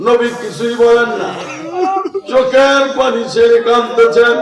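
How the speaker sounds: high, loud sermon delivery; full-force preaching. Acted. Preacher tells of the Prophet weeping silently, full-force preaching. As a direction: cry it out to the congregation.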